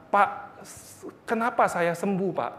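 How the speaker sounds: menangis gemetar. Retold or imitated. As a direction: imitate a trembling, tearful man, softly. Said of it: "gemeteran, nangis, berkata". (menirukan orang menangis dengan suara pelan)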